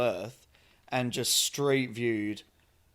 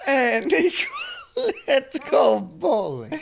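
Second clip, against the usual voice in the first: put-on high, laughing Eastern European accent; GTA cousin voice; 12 semitones higher, 9 dB louder than usual. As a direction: giggle through the cousin impression. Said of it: "Just repeating G T A four".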